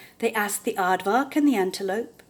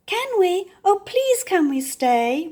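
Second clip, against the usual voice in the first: eager begging; 9 semitones higher, 6 dB louder than usual. Acted. Raymond begs.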